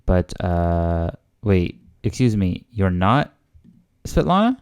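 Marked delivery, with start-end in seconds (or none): 1.4-4.6 taken aback, pitch leaping on the name; double-check in puzzlement, ending sharply up; confused question. Acted. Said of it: "like, she's confused".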